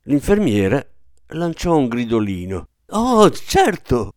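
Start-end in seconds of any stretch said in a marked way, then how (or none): 2.9-4.1 high, drawn-out slow cry; hysterical exclamation. A narrator's reading. Nurse horrified by the father, hysterical. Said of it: "esclamò isterica".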